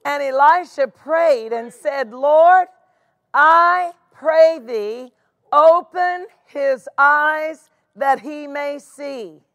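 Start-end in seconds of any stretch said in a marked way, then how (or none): 2.1-9.4 earnest prayer. Acted. Elisha prays aloud.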